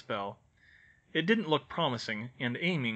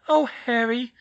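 groaned; 12 semitones higher, 9 dB louder, slower than usual. A narrator's reading. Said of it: "she groaned".

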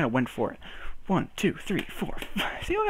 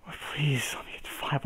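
soft unvoiced begging under the breath; whispered plea; 8 dB quieter than usual. Real, not acted. Juggler begging himself to reach five, whispered. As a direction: whisper it like a desperate prayer.

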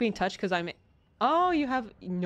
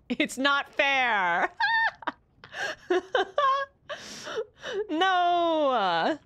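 mock sobbing; 8 semitones higher, much slower than usual. Fake sobbing in game.

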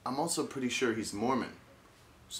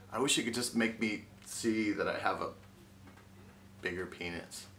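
ordinary delivery

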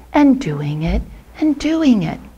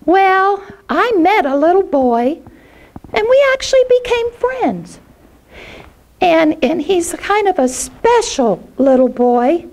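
put-on high, quick, stammering child voice; a small boy pleading to be friends; shy little boy; 10 semitones higher than usual. Jackie Paper telling Puff he has no friends, high shy child voice.

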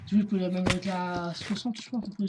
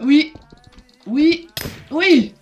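excited shout; 7 semitones higher, 8 dB louder, much slower than usual. Real, not acted. Landing hits.

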